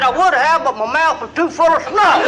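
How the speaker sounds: mock-earnest excuse breaking into laughter; laughing punchline. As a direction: deliver the snuff line cracking up.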